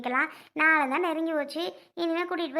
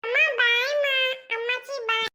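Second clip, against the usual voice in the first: put-on high, chirpy little child's voice; child saying bye; 8 semitones higher than usual. Children calling bye to mother and grandmother, high childlike. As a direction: sing out bye like a small kid.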